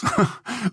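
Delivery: short high giggle after a slip; quick sheepish laugh; embarrassed giggle. Acted. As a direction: giggle it off, a little caught out. Oops, caught in a mishap.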